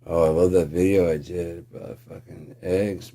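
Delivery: ordinary delivery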